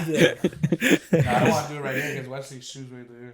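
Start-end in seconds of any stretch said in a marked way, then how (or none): 0.0-1.5 slow words through laughter; laughing. Real, not acted. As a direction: laugh while you talk.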